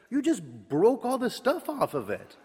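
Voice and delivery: high, pointed accusation in a different voice; accusing friend. Retold or imitated. Friend Scott accusing him of breaking the knife.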